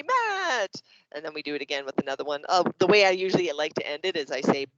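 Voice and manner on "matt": very high, drawn-out word; kids chorusing answer. Acted. Kids answering the teacher, high excited chorus. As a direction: sing out "Matt" high and long. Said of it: "And they say".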